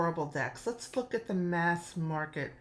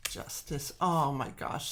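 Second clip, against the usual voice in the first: ordinary talk, nothing marked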